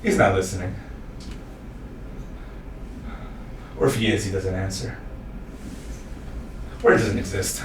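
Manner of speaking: low, soft, desolate narration; hushed storytelling. Acted. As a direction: speak sparsely into the empty silence. Hushed aftermath of breakdown.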